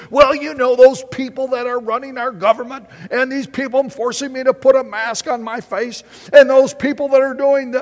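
high, loud whiny complaint; mock griping. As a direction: whine like a grumbler. Mocking people who gripe.

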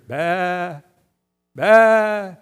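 loud bleating ram noise, rising at the end; sheep bleat. Retold or imitated. The ram appears in the thicket, loud imitated bleating. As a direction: bleat like a ram caught nearby.

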